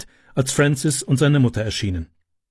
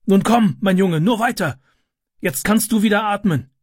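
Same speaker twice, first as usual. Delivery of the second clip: higher-pitched urgent encouragement; urging on; 8 semitones higher than usual. A narrator's reading. Rescuer urges the boy out of smoke, urging on. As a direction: coax someone along in a raised voice.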